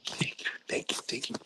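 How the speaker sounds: hushed, repeated thank-yous; whispered thanks. Retold or imitated. Commander thanking a peer for not reporting, whispering. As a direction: act out thanking someone under your breath.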